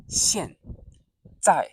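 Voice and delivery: 现 在 short, sharp, falling; fourth tone. Shown as a demonstration. Teacher models both syllables, short sharp falls. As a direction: drop hard on 现 and 在. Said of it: "fourth tone, short and sharp".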